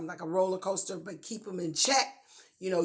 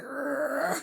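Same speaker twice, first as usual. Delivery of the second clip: higher-pitched playful growl; drawn-out "rrrr" growl; mock growl; 6 semitones higher than usual. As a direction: growl it out in pretend irritation. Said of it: "And you're sitting there like".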